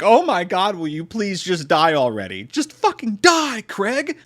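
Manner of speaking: high, exasperated begging; exasperated plea. Acted. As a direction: draw out "die" in exasperation. Exasperated viewer pleading at the film.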